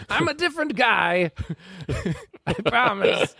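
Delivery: high, showy trainer voice giving silly advice; pompous swami trainer. Acted. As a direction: play a self-important turbaned coach. Disguised trainer denies his identity.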